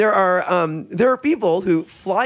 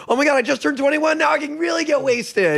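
higher, fast, giddy mock party voice; mock newly legal drinker; 9 semitones higher than usual. Mocking American twenty-one-year-olds, giddy and fast. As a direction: ham up a kid who just turned twenty-one.

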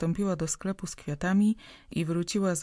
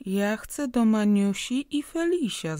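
put-on higher, louder capricious little girl; whiny child; 5 semitones higher, 6 dB louder than usual. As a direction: whine it like a spoiled child stamping her feet. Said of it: "zawołała grymaśnie Zosia". (grymaśnie, wyżej i głośniej, jak kapryśne dziecko)

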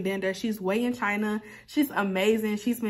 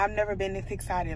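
usual speaking voice

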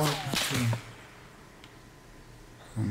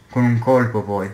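ordinary delivery

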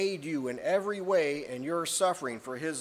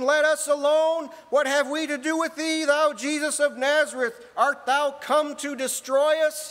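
loud, higher cry of the possessed man; demon crying out; 8 semitones higher, 5 dB louder than usual. Acted. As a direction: cry out as the unclean spirit. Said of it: "he cried out".

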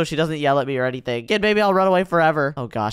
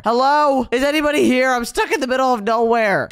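calling out; 8 semitones higher, 4 dB louder than usual. Real, not acted. Calling out in empty place.

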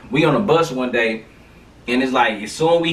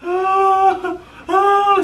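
very high, louder wailing ah; imitated sobbing wail; mock crying wail; 4 dB louder than usual. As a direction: imitate a hurt girl's cries. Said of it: "She was crying like".

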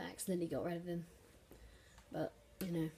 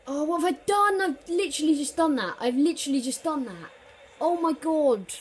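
higher, louder cry of disbelief; anguished yell; 8 semitones higher, 12 dB louder than usual. Real, not acted. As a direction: shout at your own terrible mistake. Own blunder leads to goal.

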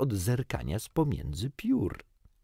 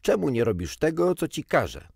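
fast, higher, louder scolding; angry yell; 7 semitones higher, 5 dB louder, faster than usual. A narrator's reading. Irritated bird scolding Peter, fast and loud. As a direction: snap it out impatiently. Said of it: "wrzasnął".

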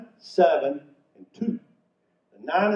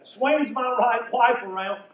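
normal talking voice

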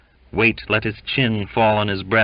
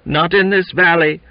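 high, loud cry; raised, urgent yell; shouted outcry; 10 semitones higher, 7 dB louder than usual. A narrator's reading. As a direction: call it out over a commotion. Acted shouting match, raised high.

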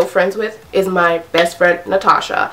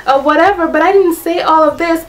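mocking, much higher sing-song fake voice; fake innocent friend; 12 semitones higher than usual. Mocking the fake friend who denies everything, higher and sing-song. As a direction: mimic a lying friend playing innocent. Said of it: "They just wanna be like".

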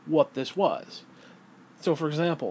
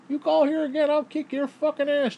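mock tough-guy threat; 12 semitones higher, 3 dB louder than usual. Quoting the man threatening the ex-husband, hammed-up. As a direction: ham up a macho threat in a squeaky voice. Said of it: "Dale T says".

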